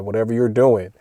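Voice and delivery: usual speaking voice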